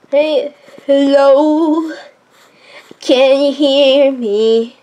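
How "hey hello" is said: ordinary talk, nothing marked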